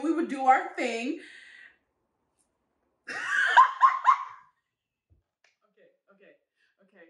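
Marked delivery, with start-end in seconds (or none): 3.1-4.3 a big, high shriek of laughter; loud burst of laughter. Real, not acted. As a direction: crack up hard at your own story. Bursts out laughing at own story.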